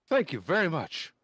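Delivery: put-on nervous teen gushing thanks; timid boy scout. GM plays the young scout.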